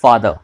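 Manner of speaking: "father" with long aa, r dropped; model father. Shown as a demonstration. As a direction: say father the British way. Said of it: "र का sound". ("father" बिना र के)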